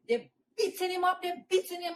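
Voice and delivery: usual speaking voice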